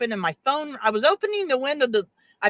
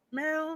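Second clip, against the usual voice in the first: high, soft cat meow; mimicked distant meow, high and faint; imitated meow; 5 semitones higher, 9 dB quieter than usual. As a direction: call like a far-off cat. Imitating cat's call.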